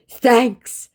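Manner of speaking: high, loud put-on voice; mock clueless scammer. Scammer thanking her cluelessly in a high mock voice. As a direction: blank cheerful thanks.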